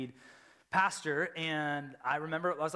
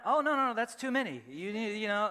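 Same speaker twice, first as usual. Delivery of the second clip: God's voice; 6 semitones higher, 4 dB louder than usual. Retold or imitated. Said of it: "like, when God's like".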